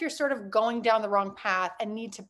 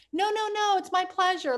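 raised, eager no-no-no, all politeness; flustered polite refusal; 8 semitones higher than usual. Retold or imitated. Refusing a customer's tip as a shy new waitress, eager and polite. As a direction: brush off the tip with a sweet high protest.

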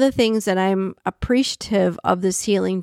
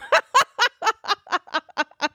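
high-pitched burst of laughter; real laugh, high and rapid; 11 semitones higher than usual. Real, not acted. Laughing at yourself for not recognising someone, high giggling burst.

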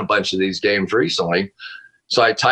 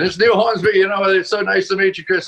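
hammed-up British accent, much higher than usual; bad British accent; 10 semitones higher than usual. Retelling how the British caller introduced himself, badly faked British accent. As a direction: imitate a polite Brit in a high, unconvincing accent. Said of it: "with this British accent".

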